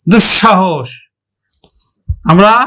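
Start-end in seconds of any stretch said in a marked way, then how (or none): 0.1-0.9 দুঃসাহস joined as dushshahosh; doubled palatal sh. Shown as a demonstration. Teacher repeating the example, joined dushshahosh for দুঃসাহস. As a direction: say দুঃসাহস as one word with a doubled sh. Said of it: "দুইবার উচ্চারণ করব".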